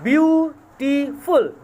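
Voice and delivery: beautiful drawn out slowly, syllable by syllable; teacher slowing down on beautiful; slow syllable demo. Shown as a demonstration. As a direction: stretch out beautiful to show its beats. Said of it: "this particular word beautiful consists of three syllables".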